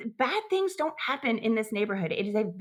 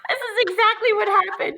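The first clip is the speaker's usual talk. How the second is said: laughing protest; 13 semitones higher, 5 dB louder than usual. Real, not acted. Laughing protest at sound-effects teasing.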